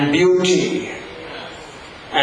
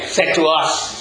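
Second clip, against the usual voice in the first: ordinary talk, nothing marked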